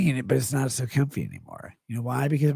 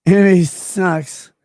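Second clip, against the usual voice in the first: slow loud declaration; 5 semitones higher, 9 dB louder, much slower than usual. Acted. Drawn-out declaration.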